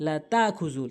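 লা তাখুযু with a smooth long "taa"; missed hamza. Shown as a demonstration. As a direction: say তাখুযু with no catch after "ta". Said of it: "অধিকাংশ লোক যে ভুলটি করে".

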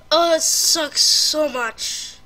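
slow, loud moan of defeat; drawn-out, loud whine; loud lament. Real, not acted. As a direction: drag it out in loud dismay. Lamenting the loss.

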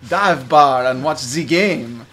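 pompous fake French drawl; French accent. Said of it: "my French, uh, mumblings".

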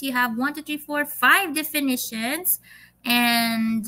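usual speaking voice

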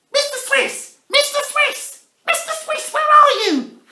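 put-on very high child calling. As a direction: call after him anxiously. Alfie calls lost mouse.

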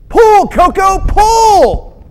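high, loud drawn-out shout; farmer's yell. Acted. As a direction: bellow a command to the horse. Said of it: "the farmer yelled".

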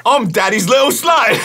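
mock impersonation. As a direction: shout it out teasing like him. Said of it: "Attempt to impersonate the other person".

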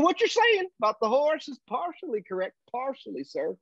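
high-pitched mock Texan cowboy; thick Texan accent. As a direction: cut in with a hammed-up Texas twang. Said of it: "and this thick accent".

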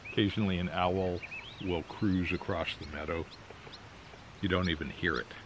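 talking around pipe stem; words through clenched teeth; pipe in mouth. Real, not acted. Seen 2: Pipe stem clenched in mouth in every labelled frame while speaking.

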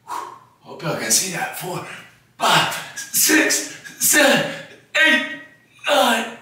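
strained counting under effort; straining through reps. Real, not acted. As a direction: grunt out each rep. Counting reps mid push-up set.

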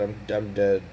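ordinary talk, nothing marked

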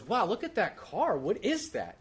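put-on high, slow, wondering kid voice; Noah. Child spotting a hearse from the car, wowed. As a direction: imitate a child marveling at something. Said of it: "And Noah goes".

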